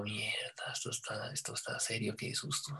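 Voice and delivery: retold panicked thought, whispered under the breath; frightened whisper. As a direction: whisper it to yourself, scared stiff.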